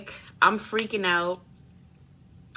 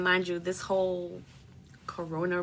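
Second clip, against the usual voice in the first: ordinary delivery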